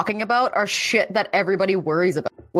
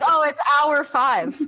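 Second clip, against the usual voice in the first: mock sweet; 9 semitones higher, slower than usual. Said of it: "I'd be like".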